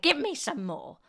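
high, loud demand; excited cry. A narrator's reading. Grandma demands another dose from George, excited cry. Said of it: "she cried".

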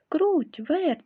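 high, loud call across the yard. Acted. Rooster summoning the mice, loud high call. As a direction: rooster calls the mice over. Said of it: "покликав півник".

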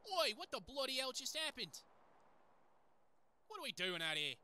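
high, loud, hammed-up Australian; Australian accent. Game character startled by what just happened, Australian accent. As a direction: exclaim in a put-on Aussie accent. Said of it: "Man, my Australian accent".

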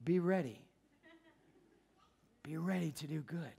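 quiet, halting, moved delivery; choked up. Real, not acted. Preacher moved by his own story, quietly choked up. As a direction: let it sink softly, moved, with long pauses. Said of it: "I get choked up".

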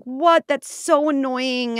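high, loud slow complaint; whiny passengers. Pretend. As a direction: moan like annoyed passengers. Passengers whining.

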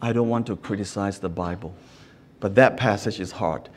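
low, soft, subdued reply; quiet reply. Retold or imitated. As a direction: quote her quietly, pitched well down. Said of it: "said quietly".

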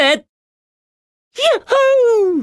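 high ringing yahoo; joyful whoop. Acted. Hero cheering as he speeds along, high whoop. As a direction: let out a high whoop of joy.

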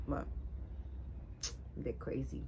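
breathy whispered throwaway; whispered aside. Real, not acted. To viewers, whispering about her crazy bangs, quietly.

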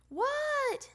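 high, loud shout of reply; yelling back. A narrator's reading. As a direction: holler back like a called child. Child yelling back to librarian's call.

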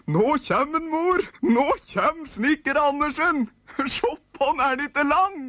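put-on high, loud squeaky children's voices; excited nisse kids. Kids calling to mother about the tall carpenter, squeaky shouting. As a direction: shriek with delight like tiny elves.

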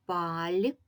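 "Паль-то" split into two drawn-out syllables with a gap; clap-out beats on "Паль-то". Shown as a demonstration. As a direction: say Паль, pause long, then то. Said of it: "вимовимо це слово так, щоб почути".

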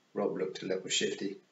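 plain reading voice

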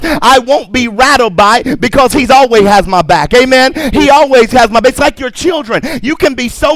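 full-voiced preacher declaring over the crowd; preaching. Pastor exhorting the congregation, preaching. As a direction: preach it with rising conviction.

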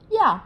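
Dạo started high and loud, Mandarin yào; Dạo punched high then dropped. Shown as a demonstration. Teacher shows students to start Dạo high. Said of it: "Cao lên".

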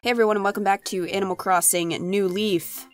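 usual speaking voice

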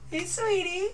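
put-on high sweet baby-talk voice; mock-sweet high greeting; cutesy greeting. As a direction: coo it up high like to a pet.

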